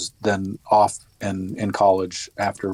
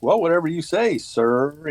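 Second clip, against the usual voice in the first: mock-deferential sarcastic sir; 8 semitones higher than usual. Veteran mimicking his friend's sarcastic salute to rank. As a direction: lay on fake respect, pitch up. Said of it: "Just a sarcastic "sir".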